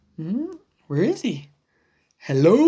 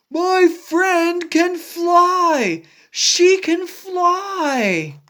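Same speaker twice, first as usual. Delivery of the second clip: higher, slow proud cheer; excited cheer; 7 semitones higher, slower than usual. Acted. Gerald cheering for Piggy: high and slow. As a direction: announce it to the world.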